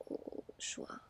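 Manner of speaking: plain reading voice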